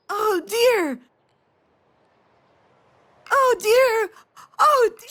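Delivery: put-on high, excited child's cry; imitated screaming. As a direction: imitate a small child calling out while pointing.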